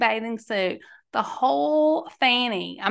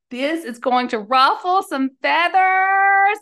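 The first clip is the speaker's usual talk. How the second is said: loud, raised warning; knowing, louder aside; bracing warning; 7 semitones higher, 6 dB louder than usual. Real, not acted. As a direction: brace them for pushback. Bracing for backlash.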